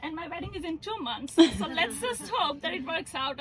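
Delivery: normal talking voice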